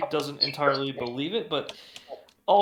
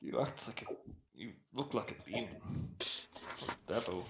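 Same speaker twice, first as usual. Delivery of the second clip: much softer and lower, barely voiced; hushed scared guard; 4 semitones lower, 12 dB quieter than usual. Guard to Ghost Rider who spared him, hushed. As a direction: say it under your breath as a wary guard.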